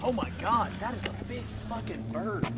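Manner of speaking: ordinary delivery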